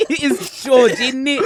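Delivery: laughing echo. Real, not acted. Echoing joke while laughing.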